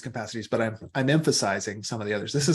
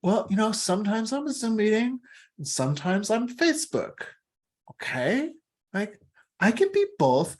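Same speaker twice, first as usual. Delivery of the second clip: mock wiser self; 10 semitones higher than usual. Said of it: "we can go like".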